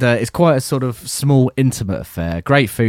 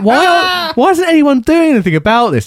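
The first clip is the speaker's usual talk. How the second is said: mock indignant; 3 dB louder than usual. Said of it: "I was like".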